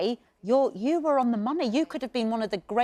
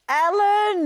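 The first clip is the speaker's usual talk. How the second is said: excited welcome; 11 semitones higher, 7 dB louder than usual. Real, not acted. Host excitedly greets guest.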